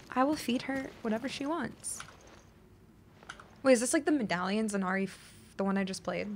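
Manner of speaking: ordinary talk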